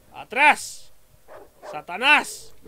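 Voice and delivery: hammed-up warding yell; mock exorcism shout. Real, not acted. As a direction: drive the monster off. Attacked at night in game.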